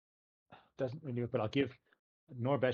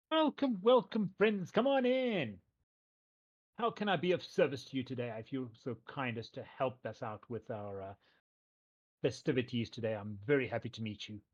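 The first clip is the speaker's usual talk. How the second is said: cheerful mayor; 3 semitones higher, 7 dB louder than usual. Acted. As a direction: greet the guests like an eager showman.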